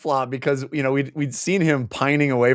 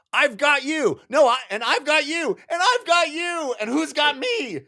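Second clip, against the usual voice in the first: very high cartoonish calls; mock frantic calling; 14 semitones higher than usual. Acting out each person holding the next, mock frantic. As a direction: call down a line of rescuers, pitched way up. Acts out chain of rescuers.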